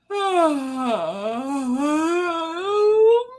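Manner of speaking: long, open, deliberate yawn; big yawn. Real, not acted. Leading the chat in a yawn, big deliberate yawn. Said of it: "Coco, you yawning?".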